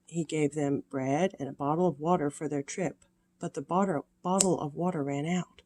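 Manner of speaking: usual speaking voice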